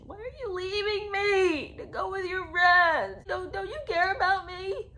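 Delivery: high, loud, tearful mock whine; hammed-up wailing guilt trip; mock emotional manipulation. Podcaster imitating a girlfriend guilt-tripping him, mock crying. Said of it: "start that emotional manipulation".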